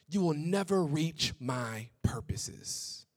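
slow, hushed whisper. Acted. God warning Seth, whispered slowly. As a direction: let it sink in with a slow whisper. God's words to Seth, repeated.

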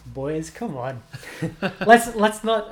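laughing through a mild telling-off. Real, not acted. Laughing while scolding the boys.

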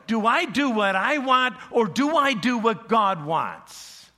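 loud, high voicing of the dilemma; anguished question. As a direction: cry out the choice at the crossroad. Inner dilemma voiced aloud.

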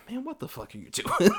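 high, laughing exasperated outburst. Retold or imitated. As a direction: throw up your hands, half laughing. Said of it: "there's an exasperation there".

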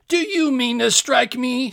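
high, loud outraged challenge; furious shouting. A narrator's reading. Giant seizing a heavy club, shouting furiously. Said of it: "shouted the other, furiously".

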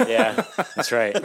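slow laugh through the words. Real, not acted. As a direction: chuckle as you agree. Laughing at friend's joke.

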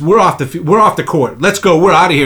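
hammed-up walkout command; mock storming off. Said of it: "He goes".

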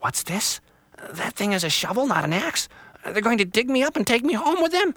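put-on high, loud, thrilled young tree; joy breaking through relief; excited little tree. Little Tree realizes she'll be replanted, high and thrilled.

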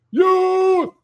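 high, loud, drawn-out holler; imitated wrestler's yell. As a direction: belt it out like a wrestler's ring yell. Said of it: "he yells".

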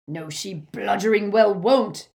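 bellowed. A narrator's reading. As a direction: bellow it back at her. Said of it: "bellowed Amycus".

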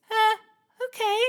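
high nervous little voice; gingerbread man voice. Acted. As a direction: squeak it out, high and unsure.

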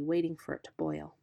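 usual speaking voice

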